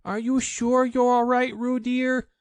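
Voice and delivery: high, loud anxious mother's call; Kanga anxious. A narrator's reading. Kanga calling to Roo in the water, anxious and high. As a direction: call out worried for your child.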